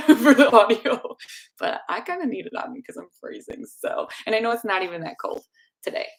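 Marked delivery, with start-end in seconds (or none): none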